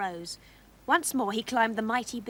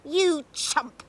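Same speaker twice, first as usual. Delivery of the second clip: louder, higher yelled insult; angry yell; 5 semitones higher, 7 dB louder than usual. A narrator's reading. Mother flings the bean away, angry yell at Jack. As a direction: hurl it at him in exasperation. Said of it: "she yelled".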